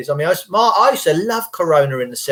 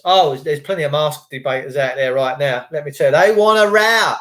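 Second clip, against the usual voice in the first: ordinary talk, nothing marked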